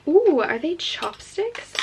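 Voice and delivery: high, slow delighted ooh; excited ooh. Real, not acted. Unboxing, thrilled to find chopsticks, delighted and drawn out. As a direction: draw out the thrill of discovery. Opening box, finds chopsticks.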